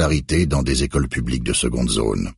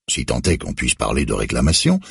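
ordinary delivery

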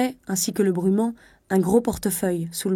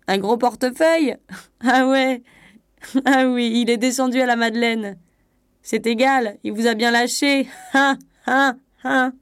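loud, higher, laughing through the words; mocking laughter; 5 semitones higher, 4 dB louder than usual. A narrator's reading. Conductor laughing at the abandoned wife, mocking. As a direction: jeer at her with open ha-ha laughs. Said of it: "L'employé se mit à rire".

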